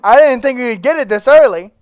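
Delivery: loud, thrilled reveal; excited. Real, not acted. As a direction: shout the result with glee. Reacting to wheel result.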